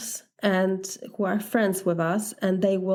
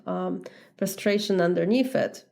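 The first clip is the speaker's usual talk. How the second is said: ordinary talk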